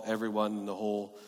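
ordinary talk, nothing marked

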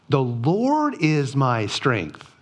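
"Lord" stressed, whole line slowed right down; deliberate weighty declaration; slow emphasis. Acted. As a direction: lean on each word slowly. Preacher stresses key verse.

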